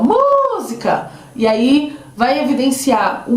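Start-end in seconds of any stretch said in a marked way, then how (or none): 0.0-1.1 drawn-out, high sing-out call; long high-pitched hail; calling at the gate. Shown as a demonstration. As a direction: stretch the first syllable high, as if calling someone at the gate. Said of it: "eles chamem no portão".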